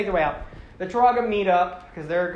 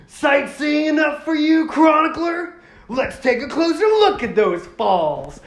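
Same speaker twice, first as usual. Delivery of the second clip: higher, louder, cocky show-off hero; 9 semitones higher, 8 dB louder than usual. Retold or imitated. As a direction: brag like a hotshot surfing lava. Tahu voiced mid-stunt.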